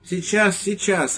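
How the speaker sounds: put-on high, hurried old man's call through the door; flustered old priest. Priest calling to a knock at his door, high and flustered. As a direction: call out high, caught off guard. Padre answers knock from inside.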